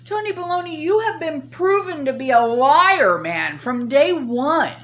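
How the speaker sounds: loud, high, heated tirade; angry rant. Real, not acted. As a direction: tear into him, furious. Ranting at the official.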